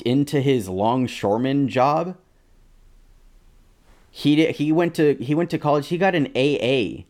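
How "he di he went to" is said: ordinary delivery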